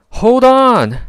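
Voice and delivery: rally the team; shout. A narrator's reading. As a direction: leader calls team to battle. Battle call.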